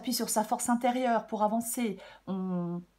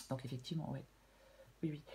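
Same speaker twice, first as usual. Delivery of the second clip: ordinary talk, nothing marked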